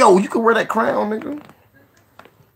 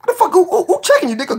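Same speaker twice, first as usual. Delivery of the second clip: incredulous; 13 semitones higher, 4 dB louder than usual. Real, not acted. Mocking the missing defender.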